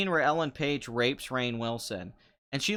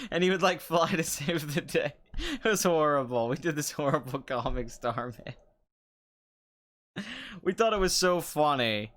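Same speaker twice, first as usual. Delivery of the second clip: normal talking voice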